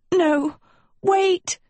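high, desperate shout; panicked cry. Acted. Sophie cries out in panic.

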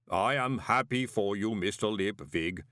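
unbothered and polite; lightly voiced placid golem; calm golem. A narrator's reading. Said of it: "said Pump calmly".

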